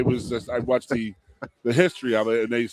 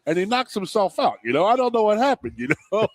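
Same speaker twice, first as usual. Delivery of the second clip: put-on Ed Van Heppen's Western Canadian accent; 8 semitones higher than usual. Flyers announcer shrugging off his elbow to the camera, Western Canadian accent. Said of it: "he had that Western Canadian accent. He talked like this".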